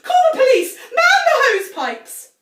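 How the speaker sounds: high, loud panicked yelling; panicked shout. A narrator's reading. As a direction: yell it in alarm.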